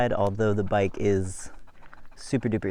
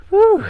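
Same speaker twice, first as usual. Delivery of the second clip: loud, high-pitched "woo"; high whoop; 11 dB louder than usual. Real, not acted. Biker climbing to a lovely view, high whoop. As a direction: let out a real whoop of effort.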